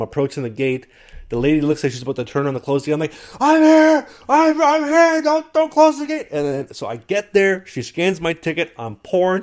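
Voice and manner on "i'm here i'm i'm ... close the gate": very high, loud frantic yell; acted plea screamed across a distance; retold shouting. Late passenger to gate lady closing up, mock frantic shout. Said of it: "I'm shouting at this point".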